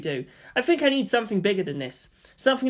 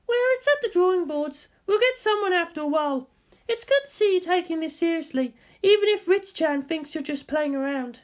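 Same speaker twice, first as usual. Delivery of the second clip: high, lively woman's voice; bright teasing guardian; Misato voice; 12 semitones higher than usual. Acted. Misato questions Shinji at the target, high lively voice.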